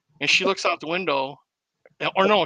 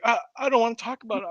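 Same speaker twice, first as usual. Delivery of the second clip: shaken refusal; 7 semitones higher, much faster than usual. Retold or imitated. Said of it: "And she's like".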